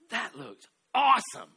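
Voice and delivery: high, loud, slow mock awe; impressed gasp. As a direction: act out the friend's wide-eyed wonder. Friend marvels at soda picture.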